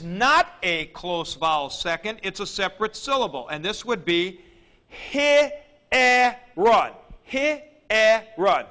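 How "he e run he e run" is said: he-e-run, each of the three syllables pulled apart, loud and high. Shown as a demonstration. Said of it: "Three syllables".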